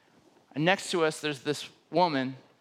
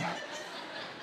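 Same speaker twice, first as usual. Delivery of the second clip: a quick breathless nervous laugh; 13 dB quieter than usual. Real, not acted. To himself as a stranger starts crying, a nervous laugh. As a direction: laugh it off, flustered.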